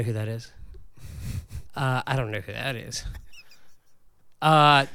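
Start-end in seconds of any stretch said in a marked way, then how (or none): none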